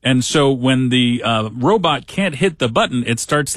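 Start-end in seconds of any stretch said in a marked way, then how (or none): none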